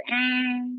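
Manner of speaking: long, high, steady horn blare; imitated car horn. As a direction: hold one droning note like a stuck horn. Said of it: "all I hear for the next twenty minutes is".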